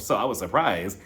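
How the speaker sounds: ordinary talk, nothing marked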